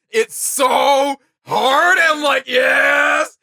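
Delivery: loud, high, stretched-out hype shout; excited shout. Real, not acted. Host hyping the song's drop to co-host, in an excited shout. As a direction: yell it like the beat just dropped.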